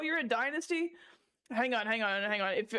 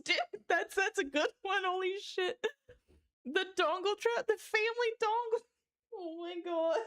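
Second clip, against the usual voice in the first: high, squeaky voice cracking with laughter; laughing too hard; 7 semitones higher than usual. Real, not acted. Streamer reading chat's dongle jokes, laughing too hard to talk. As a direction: wheeze the words out in helpless hysterics. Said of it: "making me laugh too hard".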